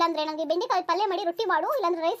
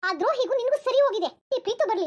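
pitched-up angry outburst; angry threat; 5 semitones higher, 3 dB louder than usual. Acted. Mother-in-law threatens to tell Preethu, angry. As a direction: lash out high and threatening.

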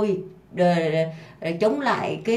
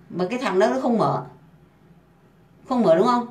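ordinary talk

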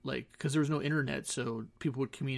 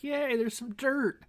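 much higher, slow sarcastic yay; mock cheer; 11 semitones higher, slower than usual. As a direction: fake excitement over nothing. Sarcastic arrival at barren island.

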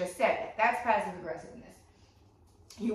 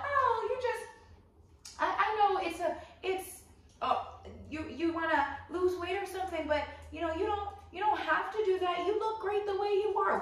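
much higher, cooing fake reassurance; fake supportive; 10 semitones higher than usual. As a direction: gush insincerely in a high voice. Fake-supportive friend's lie, acted.

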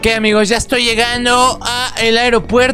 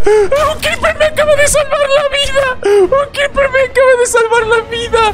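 excited shout; 12 semitones higher than usual. Real, not acted. Saved by a Creeper.

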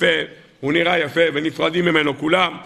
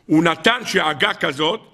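ordinary delivery

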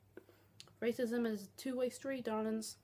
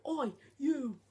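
lightly voiced rude stranger snapping at him; a hint of an annoyed passer-by telling him off; angry stranger; 3 semitones higher than usual. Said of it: "they started going".

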